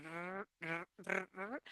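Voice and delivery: "r" in short, soft separate trills; R transition drill. Shown as a demonstration. Teacher drilling that difficult transition, soft repeated trills. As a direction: softly roll each "r" on its own. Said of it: "that transition".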